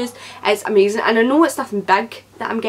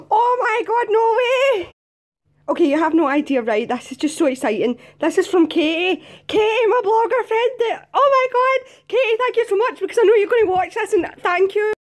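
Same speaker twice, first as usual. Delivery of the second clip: shrieking jackpot winner; 11 semitones higher than usual. Real, not acted. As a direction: shriek like you just won. Discovering the card is from Katie.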